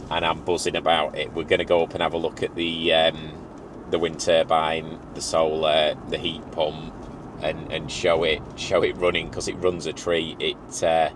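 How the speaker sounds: ordinary talk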